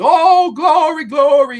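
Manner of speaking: loud, high, slow mock preacher wail; belted, drawn-out preacher cry. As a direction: draw out the praise like a hammed-up preacher. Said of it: "he's this preacher at the end".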